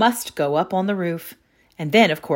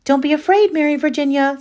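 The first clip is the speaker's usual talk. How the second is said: put-on high, loud girl's reassurance; Louisa's voice; 9 semitones higher, 5 dB louder than usual. A narrator's reading. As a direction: call up brightly to calm her. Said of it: "said Louisa".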